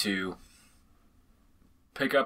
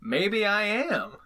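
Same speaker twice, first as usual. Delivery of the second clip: coy; 10 semitones higher, slower than usual. Pretend. Coy reply about plugging their podcast.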